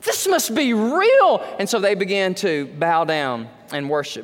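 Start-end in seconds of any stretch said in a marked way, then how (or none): 0.0-1.4 high, slow, wonderstruck exclamation; stretched out, amazed and in awe; awed crowd. Pretend. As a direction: gasp it out like people seeing a miracle. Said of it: "the people of the world are gonna go".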